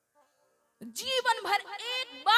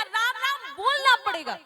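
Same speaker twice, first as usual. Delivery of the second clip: higher, louder, insisting hard; insistent command; 6 semitones higher, 7 dB louder than usual. Acted. Saint insisting the stubborn man must say Ram Ram. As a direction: demand it firmly, no arguing.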